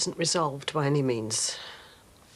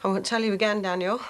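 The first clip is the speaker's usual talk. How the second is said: stern warning; 3 semitones higher, 6 dB louder, faster than usual. Acted. Mother warning her son.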